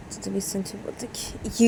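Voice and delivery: ordinary delivery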